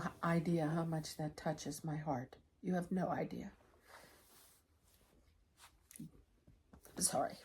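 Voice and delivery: choking up, words fading to a whisper; real tears breaking through softly; holding back tears. Real, not acted. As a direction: voice catching on the edge of crying. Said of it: "I told myself I wasn't gonna cry".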